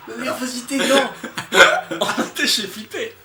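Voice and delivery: high, loud laughing outcry. Real, not acted. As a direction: yell through the giggles. Laughing, reacting to scene.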